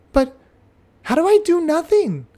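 confused little pupil asking back; puzzled child. Retold or imitated. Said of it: "The child".

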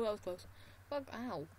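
ordinary delivery

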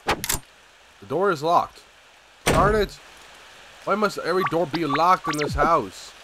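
high, loud exasperated complaint; frustrated. Real, not acted. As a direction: drag the first line, then blow up. Another locked door.

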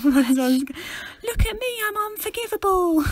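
high, drawn-out mock whine; mock them in a silly high-pitched English voice; exaggerated sing-song mockery, way up high. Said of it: "I have to mock them".

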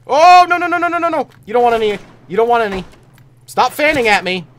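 loud high panicked yelling; raging gamer. Real, not acted. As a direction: scream at the attacker. Enemy attacks him.

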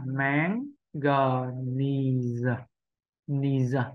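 "máng, G, ni giờ" broken into separate syllables with pauses; slow practice reading of "máng, G, ni giờ". Shown as a demonstration. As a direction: sound out "máng, G, ni giờ" piece by piece. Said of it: "Nếu bây giờ mình đọc chậm".

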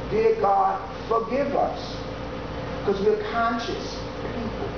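ordinary talk

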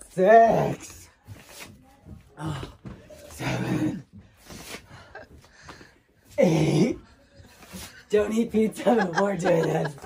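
panting through burpee counts; winded, counting between gasps; out of breath. Real, not acted. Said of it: "I'm a little out of breath".

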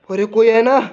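ordinary delivery

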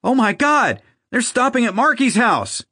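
very high, alarmed cry; panicked scream. A narrator's reading. Sister runs back into the house with news, screaming in alarm. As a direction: cry out in shock, pitch held way up. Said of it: "she screamed".

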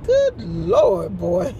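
high, loud exclamation of amazement; mock astonishment. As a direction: exclaim it, marveling at those lungs. Acts out reaction to baby's screams.

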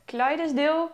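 "Clydesdale" in three syllables, KLY-des-dil; unsure pronunciation. Shown as a demonstration. Vlogger guessing at a horse breed's name, "Clydesdale" as KLY-des-dil. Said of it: "geen idee hoe je het uitspreekt".

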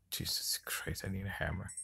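under the breath, very soft; exasperated muttering. Real, not acted. Player searching for a lost hammer, exasperated muttering. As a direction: mutter it wearily, barely voiced.

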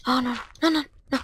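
high, soft worried pleading. Real, not acted. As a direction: plead under your breath. Danger in game.

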